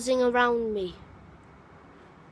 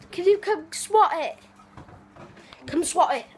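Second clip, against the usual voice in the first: pleading; 6 semitones higher, 4 dB louder than usual. Real, not acted. Pleading with dad.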